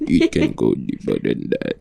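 deep voice. Shown as a demonstration. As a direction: drop the voice very low. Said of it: "This is the deepest I can go".

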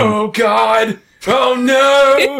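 high, loud, panicky fake-American voice; mock American accent. Villain pretending to be a hostage, hammed-up American accent. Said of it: "he's American all of a sudden".

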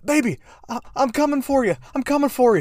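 very high, loud hammed-up lover; mock devoted lover. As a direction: cry it out like a melodramatic hero. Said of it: "he's like".

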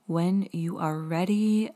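slow, gentle meditation voice; guided meditation. Acted. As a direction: guide the breathing calmly and slowly. Leads a guided breathing meditation.

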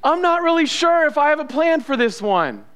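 high-pitched, hammed-up panic; comic high-voiced dithering; mock freaking out. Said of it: "freaking out going".

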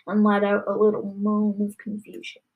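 ordinary delivery, nothing marked

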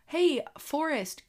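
put-on little boy calling. Toddler son calling baby brother.

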